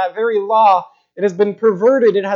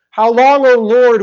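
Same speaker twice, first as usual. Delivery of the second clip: anguished cry; 3 semitones higher, 6 dB louder than usual. Retold or imitated. Prophet crying out to the Lord, loud anguished cry. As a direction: cry it out to heaven. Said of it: "crying out to God".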